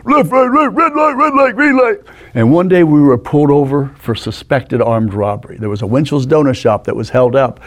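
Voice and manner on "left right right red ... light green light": very high, squeaky impression of a friend; put-on squeaky voice, pitched way up. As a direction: squeal out driving directions in imitation. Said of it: "a little high squeaky voice".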